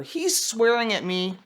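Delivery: usual speaking voice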